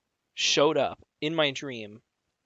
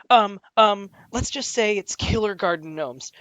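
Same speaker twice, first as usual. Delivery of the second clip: mock scared friend; 6 semitones higher, 6 dB louder than usual. Friend's scared excuse retold.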